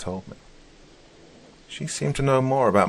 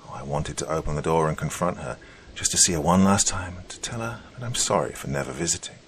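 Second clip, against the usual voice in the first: ordinary delivery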